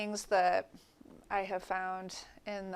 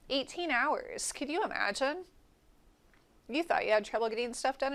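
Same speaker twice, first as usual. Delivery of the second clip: higher-pitched, wondering exclamation; amazed; 5 semitones higher than usual. Real, not acted. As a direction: marvel at it, pitch lifted. Marvels at shorter ancient days.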